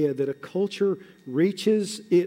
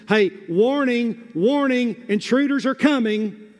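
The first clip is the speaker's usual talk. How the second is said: raised, high, slow alarm call; watchman's warning call; 6 semitones higher, 3 dB louder than usual. Acted. Watchman warning the city of intruders, urgent calling out. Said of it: "What does the watchman on the wall do with his voice? But he heeds and issues warnings".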